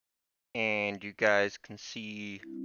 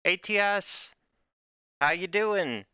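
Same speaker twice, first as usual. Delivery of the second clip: excited greeting; 10 semitones higher, 5 dB louder than usual. Real, not acted. Greeting a viewer, pitch jumps up.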